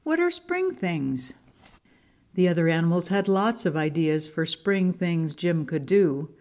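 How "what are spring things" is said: high, slow question; puzzled wondering. A narrator's reading. Monkey asks friend to explain, puzzled.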